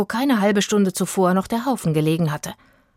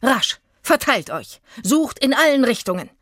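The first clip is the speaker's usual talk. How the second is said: rufend; 5 semitones higher than usual. A narrator's reading. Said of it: "rief er den Zirkusleuten zu".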